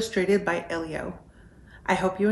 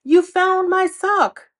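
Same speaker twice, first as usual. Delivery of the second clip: high, loud, slow exclamation; thrilled fox, much higher and louder; excited shout; 11 semitones higher, 8 dB louder, slower than usual. Acted. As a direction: burst out with joy. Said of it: "shouted Fox".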